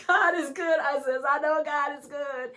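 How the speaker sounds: high, loud burst of joy; loud and elated testimony; exuberant praise. Retold or imitated. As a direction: retell it with rising, jubilant volume. Retold joyful exchange about God.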